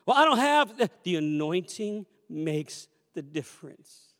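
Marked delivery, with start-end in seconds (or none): 0.1-0.9 higher, louder whiny objection; mock the doubter's excuse; listener's excuse, pitched up and louder; doubting listener voice.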